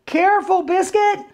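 put-on high little-girl warning. Girl warning Biscuit near the cake, high voice. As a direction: warn the puppy in a high voice.

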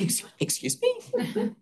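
can't believe what she's hearing; stunned disbelief. Retold or imitated. Confronted by a stranger, she answers in high stunned disbelief. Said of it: "I'm like".

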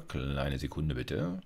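usual speaking voice

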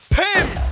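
high, loud "pem!"; one sudden high-pitched mouth-made bang; imitated hit noise. Real, not acted. Voices a hit during play. (colpo imitato, acuto e forte)

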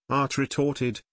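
usual speaking voice

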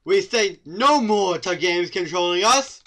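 loud, slow protest chant; mock rally shout. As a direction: declare it like a rebel leader.

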